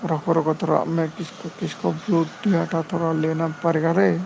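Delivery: quiet, mumbled aside to himself; low-voiced mutter off to the side; muttering to self. Real, not acted. Muttered aside away from mic. (खुद से धीमे बड़बड़ाना)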